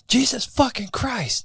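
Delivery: high, slow, stunned exclamation; a dad drawing it out in shock; dad spotting a bikini girl by the road, slow high astonishment. Retold or imitated. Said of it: "he's like".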